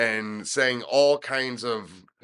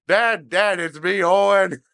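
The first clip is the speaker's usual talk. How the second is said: higher, louder mock reveal; breaking character; 7 semitones higher, 7 dB louder than usual. Owen revealing himself to his dad, higher and louder. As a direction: crack up and own the prank. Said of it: "just broke, like".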